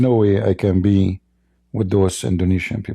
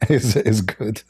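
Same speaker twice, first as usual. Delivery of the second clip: normal talking voice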